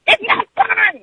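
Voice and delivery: high-pitched mock holler; shrieky imitation of yelling; imitated screaming. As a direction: screech it like an angry coworker. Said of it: "she's just hollering and screaming at me".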